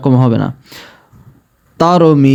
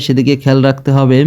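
ordinary talk

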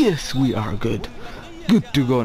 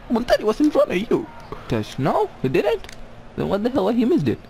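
frustrated; 10 semitones higher than usual. Real, not acted. Frustrated at missed pass.

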